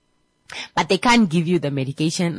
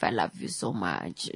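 hoarse whisper; 12 semitones lower, 10 dB quieter than usual. Retold or imitated. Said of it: "your voice is more still hoarse".

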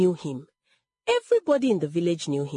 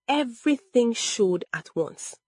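usual speaking voice

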